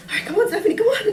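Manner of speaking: high, loud re-enacted panic; frantic self-urging. Victim fumbling a password at gunpoint, frantically urging herself on. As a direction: urge yourself on, pitch climbing.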